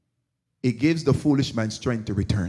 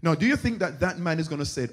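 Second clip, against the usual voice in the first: ordinary talk, nothing marked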